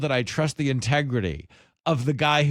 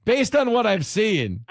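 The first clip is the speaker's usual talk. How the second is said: mock TV pundit; 8 semitones higher than usual. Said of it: "Cavuto's on, and he's like".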